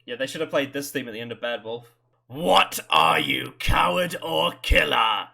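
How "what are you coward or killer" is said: slow, loud, drawn-out menace; mock villain challenge. Fan echoing a villain's taunt, slow and loud. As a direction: sneer it like a sci-fi villain.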